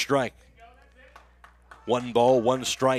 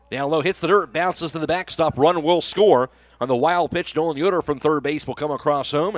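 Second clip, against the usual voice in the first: louder, higher call as the run scores; excited play-by-play; 4 semitones higher, 6 dB louder than usual. Real, not acted. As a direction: call the wild pitch with rising urgency. Live call of a scoring play.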